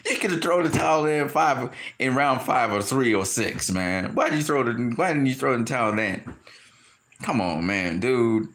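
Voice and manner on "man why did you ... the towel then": ordinary delivery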